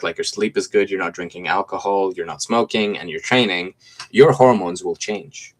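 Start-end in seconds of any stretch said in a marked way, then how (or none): none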